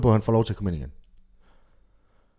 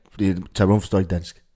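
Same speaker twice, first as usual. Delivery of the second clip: ordinary talk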